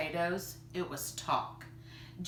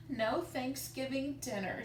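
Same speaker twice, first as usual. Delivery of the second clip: sniffled; 6 semitones higher, 3 dB quieter than usual. Acted. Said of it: "she sniffled".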